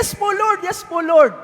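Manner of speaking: high, eager reply; eager assent. Acted. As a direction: jump at the offer of rescue. Man eagerly answers voice of God.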